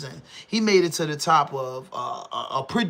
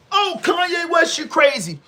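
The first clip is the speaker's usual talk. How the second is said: mocking outcry; 9 semitones higher, 4 dB louder than usual. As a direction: wail it out like the crowd jeering at him. Public mocking [name]'s remark.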